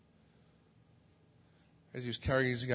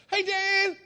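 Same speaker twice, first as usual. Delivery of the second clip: cheery call; 8 dB louder than usual. Retold or imitated. Calling out to neighbour again.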